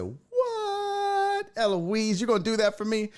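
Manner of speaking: long high squeal, then high-pitched wonder; astonished, stretched-out high reaction; amazed disbelief. Retold or imitated. Retold amazed thanks to agent.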